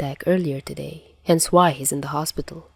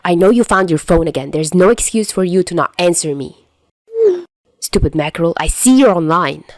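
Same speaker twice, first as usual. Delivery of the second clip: annoyed; 6 dB louder than usual. A narrator's reading. Chuya's annoyed texts.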